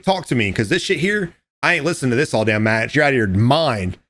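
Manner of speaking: ordinary talk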